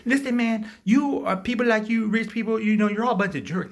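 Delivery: high, loud indignant tirade; hammed-up scolding; mock rant. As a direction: lash out like a whiny complainer. Acts out Joker berating host.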